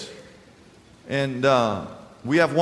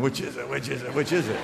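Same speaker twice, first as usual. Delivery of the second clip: hushed, nervous repeated question; anxious wondering; 5 dB quieter than usual. Retold or imitated. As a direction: mutter it softly as a scared kid. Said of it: "that was the worst fear".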